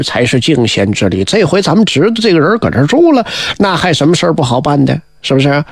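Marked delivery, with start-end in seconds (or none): none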